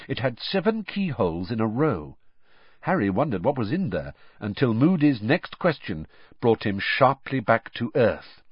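ordinary delivery, nothing marked

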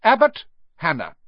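high, loud name call; projected register-reading voice; roll call. A narrator's reading. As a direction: call the name out across a hall. Teacher calls the roll.

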